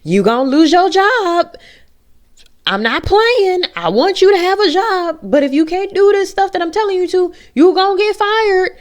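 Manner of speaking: high, loud shouted warning at ignoring coworkers; shrill-free high-pitched yelled threat of firing; mock exasperated yell. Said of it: "I'm like".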